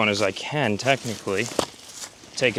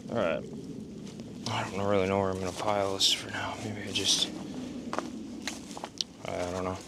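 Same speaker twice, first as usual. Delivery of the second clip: whispering; 10 dB quieter than usual. Real, not acted. Muttering to himself while working.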